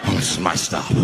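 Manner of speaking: very low, subdued put-on voice; back to senses. Crazed man finished and leaving the bus, back to his senses. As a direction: drop deep, suddenly composed. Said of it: "He came back to his senses".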